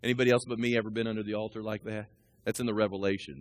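ordinary talk

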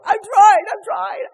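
high, loud, pleading; the husband insisting he is doing his best. Retold or imitated. Husband pleads with his upset wife, high and loud. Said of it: "he was like".

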